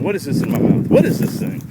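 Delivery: lightly voiced puzzled dog; a hint of a baffled pup, rising at the end; voicing the dog. Trainer voicing his dog's confusion over the pigeon, lightly puzzled. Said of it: "He's saying".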